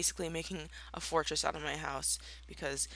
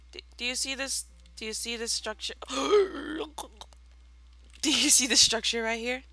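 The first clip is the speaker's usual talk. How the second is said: incredulous; 7 semitones higher than usual. Real, not acted. Showing the dinosaur prank.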